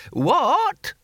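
high, rising querulous shout; deaf old man. Acted. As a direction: squawk it as if hard of hearing. Deaf old Hamish cannot hear.

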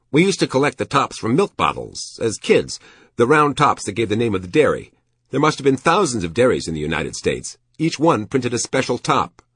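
high, animated reminiscence in character; enthusing shopkeeper. A narrator's reading.

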